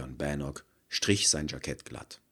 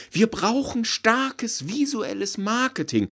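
rapturous pitch; 9 dB louder than usual. Acted. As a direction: preach the vision with zeal. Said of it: "rief von Gerteljung".

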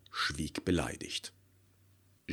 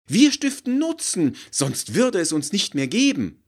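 high, loud, outraged protest; stung marketer crying out, much higher and louder; indignant outcry; 12 dB louder than usual. Acted. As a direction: fire back in indignation. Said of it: "rief von Gerteljung aufgebracht".

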